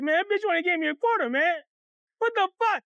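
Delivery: high, whiny mock crying; tearful complaint. Boy shows his friends the quarter, tearful outrage. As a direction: wail it like a cheated kid. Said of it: "Why you crying?".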